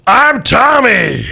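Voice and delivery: high, loud, slow dim brute; slow mutant voice. Acted. Mutant introduces itself to Jeffrey, high, loud and slow. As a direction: drag out the name proudly.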